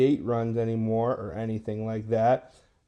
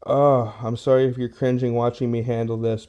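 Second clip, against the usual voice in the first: ordinary delivery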